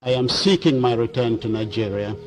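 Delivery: usual speaking voice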